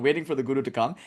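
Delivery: ordinary delivery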